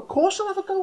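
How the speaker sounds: very high, keen squeak; mock overkeen yes; eager volunteer. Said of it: "would just be like".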